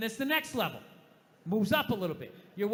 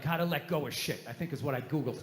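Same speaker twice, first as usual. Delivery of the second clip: ordinary delivery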